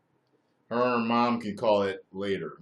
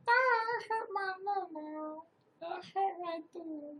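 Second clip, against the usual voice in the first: put-on much higher, slow, soft child voice; hurt little girl; 3 dB quieter, slower than usual. Little daughter telling daddy where it hurts, whimpering. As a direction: whimper like a small hurt kid. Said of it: "What happened?".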